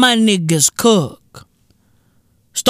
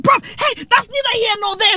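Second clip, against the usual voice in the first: high, fast brush-off; excited dismissal; 12 semitones higher than usual. Real, not acted. Comic shrugging off the phone chatter, high and fast. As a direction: wave it away up high and quick.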